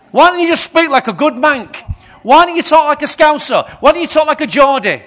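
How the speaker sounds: hammed-up annoyed questions; mock exasperated rant. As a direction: grumble at Jesus like a fed-up reader. Mock frustrated Bible reader complaining.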